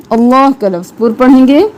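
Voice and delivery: usual speaking voice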